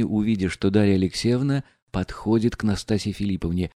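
plain reading voice

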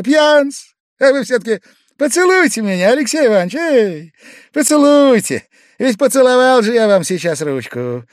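high, loud, insistent begging; Pavel Pavlovich, drunken pleading. A narrator's reading. Drunk guest insisting Alexei Ivanovich kiss him, loud pleading. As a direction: beg loudly in a high drunken voice.

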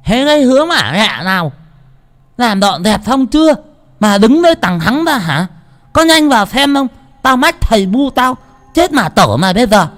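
high, loud furious yelling at the servant; scolding shout. A narrator's reading. Maid Tẹo screaming at a shadow in the yard, furious shout. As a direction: bark the threat like a vicious maid. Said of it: "mà quát".

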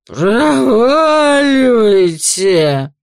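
loud slow slurred yell; drunken shout. Acted. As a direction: bellow it drunk, dragging it out. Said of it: "невнятный пьяный голос".

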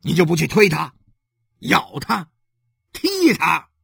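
loud, rising indignant demand; angry reproach. Acted. Husband asks why his wife didn't resist, indignant. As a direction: hammer out each verb in reproach.

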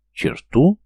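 sharp indignant high question. Acted. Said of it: "возмутился недоумок". (возмущённо)

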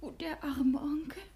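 whispered, startled questions in the dark; anxious whisper at night; hushed whisper. Acted. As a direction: keep it to a breath so no one hears. Shocked news in hiding.

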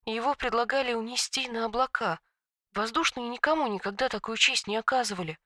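soft, hushed tragic telling; tragic whisper. A narrator's reading. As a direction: hush it like a sad secret. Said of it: "трагическим шёпотом произнесла".

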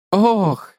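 a touch of flustered surprise; embarrassed. A narrator's reading. Spider corrected by the man, embarrassed. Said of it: "смущённо сказал кусач".

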